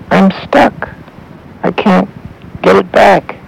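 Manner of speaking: put-on a small boy calling up for help; scared boy. A narrator's reading. Stuck boy calling up to the old man, high and scared.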